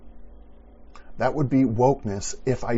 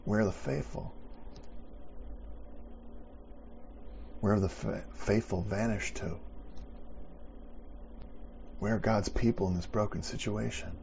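quiet reflective preaching; 9 dB quieter than usual. Acted. Quiet reflective close of sermon.

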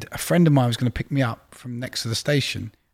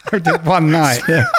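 laughing through the admission; 5 semitones higher, 6 dB louder than usual. Real, not acted. Admitting to the podcast host he exaggerated, laughing. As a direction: giggle while owning up to the fib.

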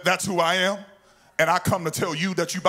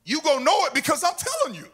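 indignant angel; 6 semitones higher, faster than usual. Acted. Angel answering Zacharias.

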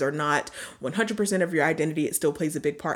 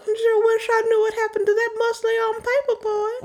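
mock lovesick lament; 3 dB louder than usual.

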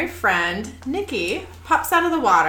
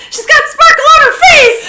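very high, loud hammed-up shriek; comic screech of alarm; panicked yell; 13 dB louder, faster than usual. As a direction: freak out at full volume. Sully freaking out in story.

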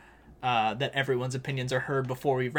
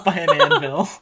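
laughing; 4 semitones higher, 8 dB louder than usual. Real, not acted. Laughs while saying it.

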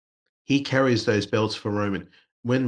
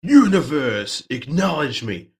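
mock Roman proclamation; 5 semitones higher, 6 dB louder, slower than usual. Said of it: "and he went".

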